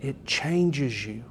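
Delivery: soft, slow and low, drawing the listeners in; a quiet, sincere plea; gentle closing appeal; hushed earnest promise. Real, not acted.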